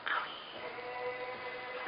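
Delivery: soft, breathy whine of Owie, drawn out high; whimpered owie. Real, not acted. Telling the camera about a paper cut, soft whimper. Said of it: "I got a paper cut".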